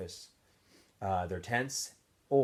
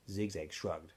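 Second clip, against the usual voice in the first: plain reading voice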